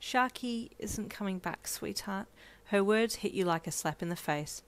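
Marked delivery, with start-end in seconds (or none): 0.0-2.2 gentle. Acted. Mother breaking news gently.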